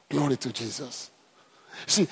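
ordinary delivery

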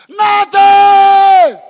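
high, loud drawn scream. Real, not acted. Preacher screams the word at the congregation. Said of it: "Sorry for screaming".

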